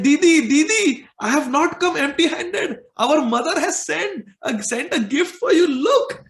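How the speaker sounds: put-on high, loud, eager child's voice with a laugh; a boy bursting to show his gift; little brother proudly presenting his sister a gift, high and laughing; excited little boy.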